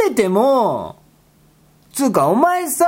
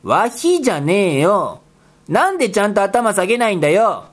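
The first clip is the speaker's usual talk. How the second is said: usual speaking voice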